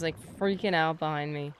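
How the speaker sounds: normal talking voice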